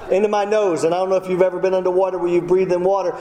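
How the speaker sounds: normal talking voice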